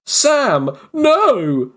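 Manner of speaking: high, dramatic cry of alarm. Retold or imitated. Reading out the fake alarm message with a dramatic cry. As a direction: wail his name like a tragedy.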